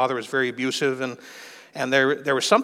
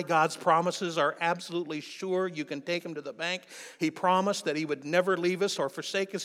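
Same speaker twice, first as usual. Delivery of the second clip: high-pitched, insistent preacher's delivery; fervent preaching; 5 semitones higher, 5 dB quieter than usual. Real, not acted. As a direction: preach the promises earnestly, voice pitched up.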